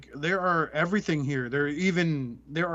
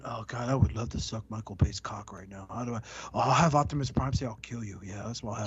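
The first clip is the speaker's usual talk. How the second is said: low, quieter mock director voice, scheming; mocking a dumb director; 6 semitones lower, 3 dB quieter than usual. Podcast host mocking a Transformers director, low mock voice. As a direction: sneer at the director in a lower, softer voice. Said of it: "this pathetic fucking dumbass director going".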